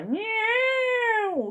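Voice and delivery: high, drawn-out imitated cat yowl; wailing cat war cry. A narrator's reading. Cat springs from lap, yowling in fury. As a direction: let the cat wail a furious war cry. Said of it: "This was his wailing war cry".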